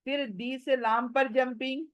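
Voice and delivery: ordinary talk, nothing marked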